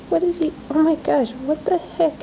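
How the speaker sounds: sudden high-pitched amazement; startled, high pitch; surprised. Real, not acted. Bear suddenly stands up.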